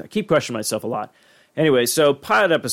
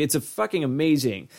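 ordinary delivery

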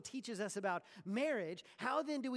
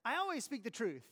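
indignant protest; 6 semitones higher, 6 dB louder than usual. Retold or imitated. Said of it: "And you might say".

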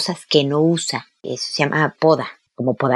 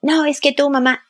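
a much higher, whiny protest from the son; defensive teen son; 10 semitones higher than usual. Acted. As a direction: play the son snapping back, voice pitched up high. Hijo replica a su madre. (hijo adolescente a la defensiva, con voz mucho más aguda)

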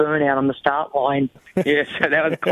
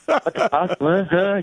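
puzzled higher-voiced what the fuck; mock baffled rider; 4 semitones higher than usual. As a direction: play the confused rival who didn't hear. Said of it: "gives me the".

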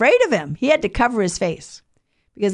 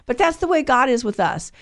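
ordinary delivery

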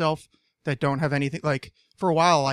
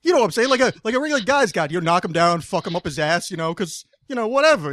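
mock New Jersey guy accent; 2 semitones higher, 5 dB louder than usual. Riffing on the saggy-pants bit, loud mock New Jersey accent. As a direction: bark it like a cartoon Jersey tough guy. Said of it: "I do that accent a lot also".

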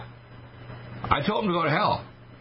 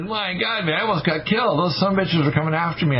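shaken exclamation; 7 semitones higher than usual. Retold or imitated. Retold fright after visiting camp.

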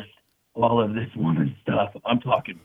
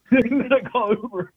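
laughing harder, pitched up; laughing, higher; 9 semitones higher than usual. Real, not acted. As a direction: say it again, still laughing.

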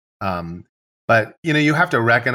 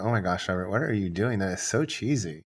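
softer, as if thinking to oneself; imitated private thought; 2 semitones lower, 8 dB quieter than usual. Couple privately judging the photographer's direction, imitated quiet thought. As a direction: drop it to a quiet imagined aside. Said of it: "what they're gonna be thinking in their head".

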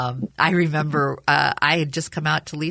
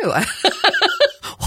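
high bursting laugh; 5 dB louder than usual. Real, not acted. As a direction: break into a delighted laugh. Laughing while retelling story.

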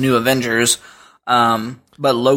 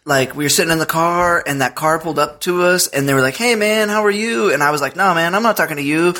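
daughter's fast-talking impersonation, higher-pitched; Luis; 5 semitones higher than usual. Retold or imitated. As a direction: retell it as a kid imitating a movie character, pitched up. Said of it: "That was my impersonation of Luis".

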